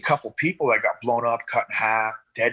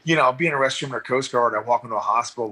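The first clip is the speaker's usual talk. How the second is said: ordinary talk, nothing marked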